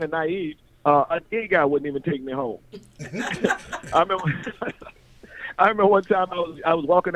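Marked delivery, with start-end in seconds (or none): 3.0-3.9 short amused chuckle; laugh. Real, not acted. Laughing at his young naive self. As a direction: laugh at how naive he was.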